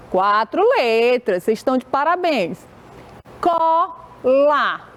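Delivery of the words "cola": "Cola" as two held pieces, co, la; syllable by syllable. Shown as a demonstration. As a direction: break "Cola" in two with a clear pause. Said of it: "Quantas vezes a tia fez movimento".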